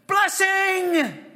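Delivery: high, loud exultant cry; shouted blessing. Shown as a demonstration. Preacher turns the battle cry into praise, loud shout. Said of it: "That's the first thing that came".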